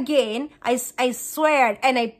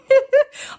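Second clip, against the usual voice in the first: high, loud burst of laughter; 14 semitones higher, 7 dB louder than usual. Real, not acted. Vlogger amused by her own phrase, laughing.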